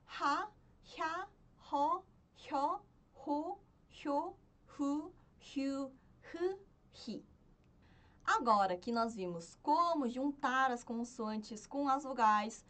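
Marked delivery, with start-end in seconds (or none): 0.1-7.2 "Ra, hya, ho" spoken singly with a lilting rise; syllable drill. Shown as a demonstration. Teacher modelling the last consonant for learners, syllable drill. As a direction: recite the row evenly, syllable by syllable.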